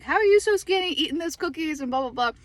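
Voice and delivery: high, loud mock friend voice, incredulous; friend's question. Mimicking a friend asking about the cookies, high and loud. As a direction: play the envious friend teasing her. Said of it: "my one friend's like".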